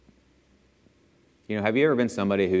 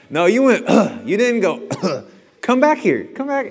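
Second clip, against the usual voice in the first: high, louder mom's rebuke; scolding mom; 9 semitones higher, 7 dB louder than usual. Retold or imitated. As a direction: call him back sharply.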